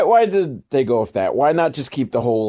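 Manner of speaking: ordinary talk, nothing marked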